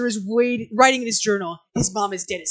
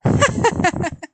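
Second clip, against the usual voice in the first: high laugh; 10 semitones higher, 5 dB louder than usual. Acted. [name] laughs at joke.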